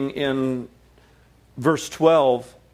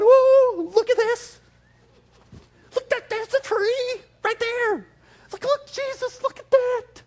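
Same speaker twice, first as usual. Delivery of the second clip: much higher, excited disciples gasping at the tree; freaking out; 3 dB louder, slower than usual. Retold or imitated. Disciples freaking out at the withered tree, high and excited. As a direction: squeal it like stunned followers. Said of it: "the disciples are just freaking out".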